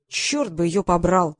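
plain reading voice

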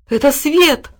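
high, delighted announcement; joyful cry. A narrator's reading. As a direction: announce the rescue happily. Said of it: "радостно замахал хвостом пёс".

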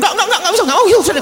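very high, rapid-fire protesting; agitated refusal. Acted. Mother brushing off the child's request, high and flustered.

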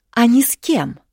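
high, loud challenge; defiant. A narrator's reading. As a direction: throw it at him as a dare. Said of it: "с вызовом в голосе". (с вызовом, громко)

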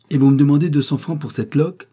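indignant. A narrator's reading. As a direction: cry out in indignation at the price. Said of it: "s'écria madame Bocken, indignée".

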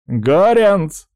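loud, high-pitched hail; calling out. Acted. Pirate threatening the man on the mast, calling out. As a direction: call up to someone overhead.

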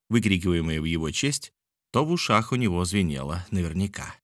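usual speaking voice

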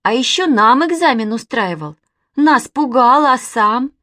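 accusing, emphatic challenge; taunting reproach. A narrator's reading. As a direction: throw his boasting back at him. Girl taunts boy for cowardice.

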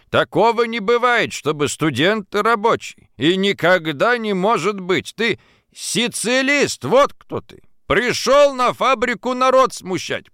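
high, indignant denunciation; heated accusation. A narrator's reading. Drunken timekeeper denouncing a worker as socialist, heated. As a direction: expose him, voice climbing with outrage.

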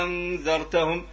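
أنذرتهم held on a very long opening aa; ibdal reading. Shown as a demonstration. Teacher repeating al-Azraq's reading, أنذرتهم with a long held aa. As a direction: draw out the first vowel of أنذرتهم as one smooth aa. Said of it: "يقرأها بالإبدال".

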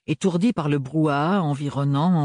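plain reading voice

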